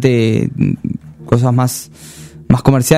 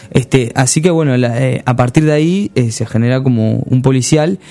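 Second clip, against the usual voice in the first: normal talking voice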